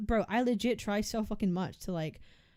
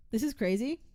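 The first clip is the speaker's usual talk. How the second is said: ordinary delivery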